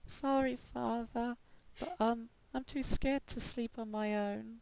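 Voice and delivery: put-on high, soft timid girl voice; small frightened girl whisper; scared little girl. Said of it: "sound like a girl".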